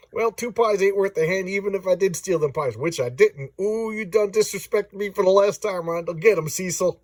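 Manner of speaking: riled hillbilly villain. Acted. Reader voices pie-thief's foe for viewers, riled hillbilly. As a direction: play a riled-up comic hillbilly. Reads comic villains' dialogue aloud.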